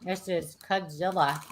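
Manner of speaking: talking while eating chips; crunching chips. Real, not acted. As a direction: mouth full of chips. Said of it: "Sorry, I'm eating chips".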